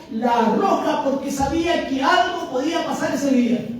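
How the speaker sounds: high, fervent, driving preaching; predicación encendida. Real, not acted. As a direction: preach with mounting conviction, pressing every phrase. (prédica apasionada a viva voz)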